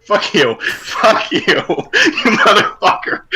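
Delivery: laughing curse; laughing through words. Real, not acted. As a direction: laugh while you swear. Laughing after falling for a prank.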